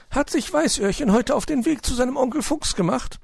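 high, fussing magpie voice; a worried bird asking at the table. Acted. Frau Elster fragt Onkel Uhu besorgt, hohe Elsternstimme.

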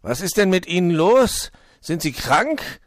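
high, loud, concerned questioning; worried fox. A narrator's reading. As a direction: ask with animated storybook alarm. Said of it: "fragte er besorgt".

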